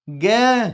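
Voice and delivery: गे held long on its final vowel; drawn-out syllable. Shown as a demonstration. Teacher testing pupils on the end sound, गे drawn out. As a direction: stretch गे out evenly. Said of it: "किसका sound है बच्चों".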